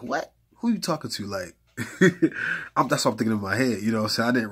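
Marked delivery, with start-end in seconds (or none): 0.0-1.3 high, startled disbelief; shocked outrage. Retold or imitated. Retold shock at Facebook insult. 1.8-2.7 loud, high burst of laughter. Real, not acted. Laughing at the memory.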